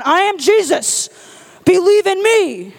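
loud, slow godlike decree; booming proclamation. Pretend. Preacher imagining Jesus arriving with lightning, slow booming decree. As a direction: proclaim it from the clouds.

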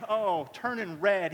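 ordinary delivery

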